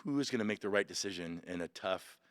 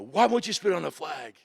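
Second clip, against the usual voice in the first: raised, loud retold question; demanding instructor; 6 semitones higher, 10 dB louder than usual. Drill instructor questions the recruit, loud demand.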